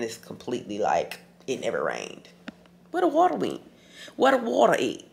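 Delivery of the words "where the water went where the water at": high, baffled question; amazed. Real, not acted. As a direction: sound truly puzzled and amused. Amazed rain vanished.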